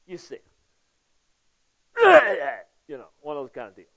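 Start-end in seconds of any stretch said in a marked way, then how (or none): 2.0-2.6 high, loud mock holler; sudden burst of road-rage noise; angry outburst. Acting out hollering at a driver who cuts in, high angry yell.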